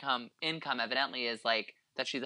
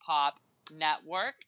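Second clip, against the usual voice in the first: big, high announcer opening settling down; 4 semitones higher, 5 dB louder than usual. Pretend. Host welcoming listeners to the podcast, announcer voice. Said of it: "like, announcer voice today".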